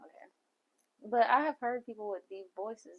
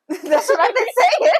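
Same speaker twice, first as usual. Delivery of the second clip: high, loud words through laughter; laughing hard; 13 semitones higher, 12 dB louder than usual. Real, not acted. Friend protests to friend over being corrected, laughing hard. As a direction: say it while cracking up. Both cracking up over grilled hash browns.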